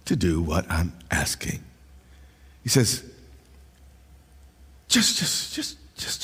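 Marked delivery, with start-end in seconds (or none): 4.9-6.2 breathless whisper, pressing urgently; whispered plea. Acted. Preacher voicing God's urgent plea.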